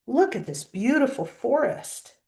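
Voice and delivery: plain reading voice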